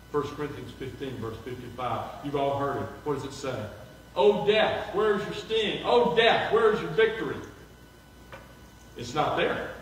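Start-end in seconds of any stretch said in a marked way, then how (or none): 4.1-7.4 loud, ringing scripture quote; louder, a touch higher, proclaiming; preaching it out. Acted. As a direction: proclaim it to the congregation.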